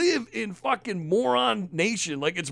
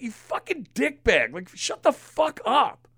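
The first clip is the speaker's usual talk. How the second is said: ordinary delivery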